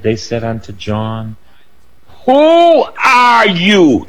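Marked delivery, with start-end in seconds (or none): 2.3-4.0 high, slow and drawn-out Who are you; Caterpillar voice. Retold or imitated. Quoting Alice in Wonderland to the congregation, in a high drawn-out voice. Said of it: "That's Alice in Wonderland".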